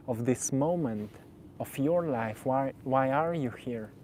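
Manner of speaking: usual speaking voice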